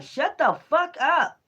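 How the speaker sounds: high, slow, menacing growl of the deity; hostile spirit voice. Retold or imitated. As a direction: voice the angry spirit stretching each word. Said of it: "That's why you be like".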